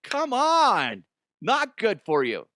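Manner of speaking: high, drawn-out mock yell; exasperated doctor. Doctor urges patient to stop, high exasperated mock cry.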